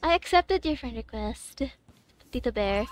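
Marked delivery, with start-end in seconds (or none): none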